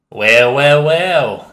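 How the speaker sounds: loud, high exaggerated Cockney drawl of well well well. Cockney man interrupting from across room, exaggerated Cockney. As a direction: ham up the Cockney, pitch climbing. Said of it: "an exaggerated Cockney voice speaks".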